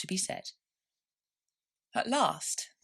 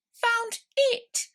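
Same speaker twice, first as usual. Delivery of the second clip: very high, loud irritated snap; cross mouse; 7 dB louder than usual. A narrator's reading. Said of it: "rather crossly".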